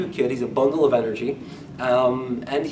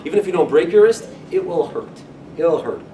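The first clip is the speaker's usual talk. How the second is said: ordinary delivery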